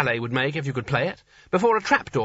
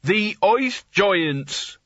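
repeated loudly with a trace of irritation, much higher; 7 semitones higher, much slower than usual. A narrator's reading. Nigel repeats the ice giants loudly when told to speak up. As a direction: say it again up loud, irritated. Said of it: "Nigel repeated loudly".

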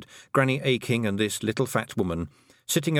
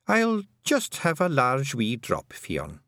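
put-on old Scottish Kelda; 6 semitones higher, slower than usual. A narrator's reading. Kelda asks for drink.

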